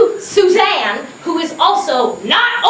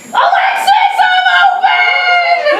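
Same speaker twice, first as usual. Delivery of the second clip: yell; 11 semitones higher, slower than usual. Retold or imitated. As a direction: shout for the ball, laughing. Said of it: "So I yell".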